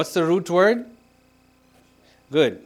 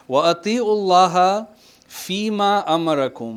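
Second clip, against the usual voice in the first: quick, raised chanted recitation of the verse; Quran recitation; 4 semitones higher, 5 dB louder, much faster than usual. Acted. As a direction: recite it briskly like tajweed. Quotes verse while teaching.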